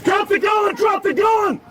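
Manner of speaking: high, urgent shouted orders; shouting commands. Real, not acted. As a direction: scream commands at a gunman. Officer confronts armed suspect.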